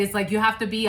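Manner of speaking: usual speaking voice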